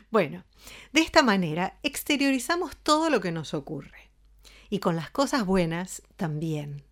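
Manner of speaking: usual speaking voice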